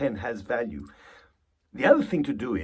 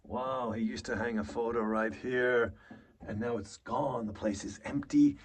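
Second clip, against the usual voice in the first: a soft, stunned murmur at an empty room; hushed dismay; 3 semitones lower, 5 dB quieter than usual. Shown as a demonstration. Actor discovering his brother's empty apartment, quietly stunned. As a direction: take it in quietly, struck by what's gone. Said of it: "create the place and go".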